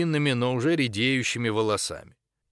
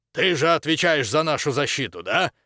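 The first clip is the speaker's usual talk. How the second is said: pointed, raised-pitch question with venom; venomous; 5 semitones higher, 4 dB louder than usual. A narrator's reading. Baron questions the one responsible for protection, venomously. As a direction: needle them with a venomous edge. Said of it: "В голосе хозяина замка появились ядовитые нотки".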